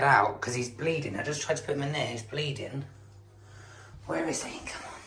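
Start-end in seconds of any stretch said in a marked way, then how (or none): 4.1-5.0 soft whisper to coax out a small animal. Real, not acted. Whispering to a hiding shrew to coax it out, hushed. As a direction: breathe it, don't wake anything.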